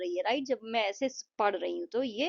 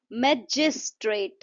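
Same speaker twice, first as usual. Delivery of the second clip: Magistrate slowed, with weight on जिस; stress on JIS; 3 dB louder, slower than usual. Shown as a demonstration. Teacher demonstrating the stress mark, magistrate stressed on जिस. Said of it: "जिस का जो sound है".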